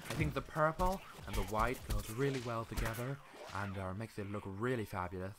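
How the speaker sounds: a hint of a fashion designer; lightly put-on fabulous designer; clothes designer voice. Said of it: "that's my voice of a, a, um, clothes designer".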